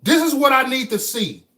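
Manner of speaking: high, loud declaration; emphatic shout. Real, not acted. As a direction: shout it out with conviction. Emphatic motivational outburst.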